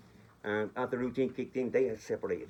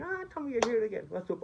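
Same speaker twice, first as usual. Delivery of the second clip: delighted woman; 11 semitones higher than usual. Retold or imitated. Woman greets Tommy in pub.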